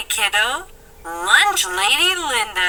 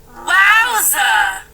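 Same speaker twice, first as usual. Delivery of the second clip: ordinary talk, nothing marked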